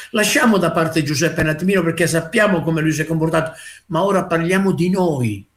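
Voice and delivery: ordinary delivery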